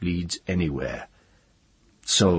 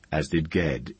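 ordinary delivery

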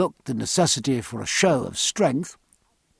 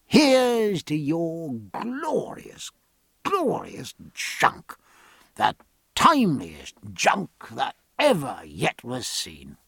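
slow, drawn-out convivial toast; grand toast; slower than usual. Acted. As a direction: savour each word as you raise the glass. Captain proposes a toast.

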